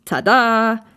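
triumphant, drawn-out flourish; proud little fanfare; proud reveal. A narrator's reading. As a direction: present it like a magician's big reveal. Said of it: "zog auf einmal stolz eine C D hervor".

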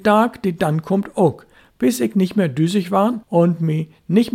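put-on high, loud, stubborn boyish voice; determined boy. Boy insisting to father he'll keep training, stubborn and high. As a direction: vow it proudly like a brave little sailor.

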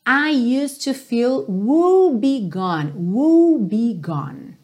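"woe begone" drawn out very slowly; slow lyric demo. Shown as a demonstration. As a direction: model the line slowly. ("woe begone" bem devagar)

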